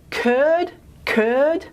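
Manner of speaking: "curd" drawn out with the tongue well forward; fronted vowel demo. Shown as a demonstration. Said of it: "test of putting the k in front".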